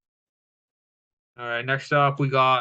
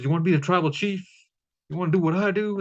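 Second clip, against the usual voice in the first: imitating an emotional rant, pitched higher and fast; mock pissed off; 5 semitones higher, much faster than usual. Retelling Roman scolding Sami, mock angry higher rant. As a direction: rant it higher like an angry boss, half-acted. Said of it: "more emotional and pissed off".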